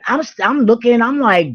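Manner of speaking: usual speaking voice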